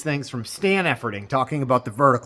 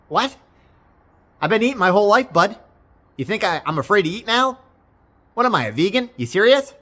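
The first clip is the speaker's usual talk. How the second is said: incredulous rant; 6 semitones higher, 3 dB louder than usual. Retold or imitated. Mocking the doctor's suggestion.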